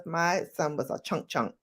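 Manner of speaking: ordinary delivery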